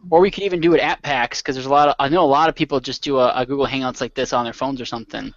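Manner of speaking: normal talking voice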